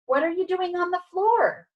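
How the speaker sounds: high-pitched, puzzled question; astonished. Acted. Dog finding his friend on the floor, astonished.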